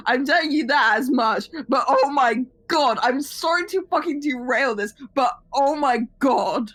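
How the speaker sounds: ordinary talk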